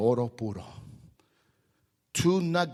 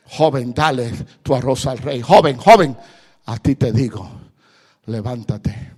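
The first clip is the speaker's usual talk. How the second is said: loud urgent appeal; preaching call; 12 dB louder than usual. Acted. Preacher urges the youth to rise, loud sermon appeal. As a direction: call them up.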